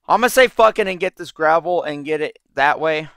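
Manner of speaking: ordinary talk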